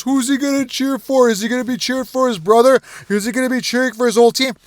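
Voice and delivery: high, put-on storyline teaser; mock hype voice. Mimicking the media's brothers storyline hype.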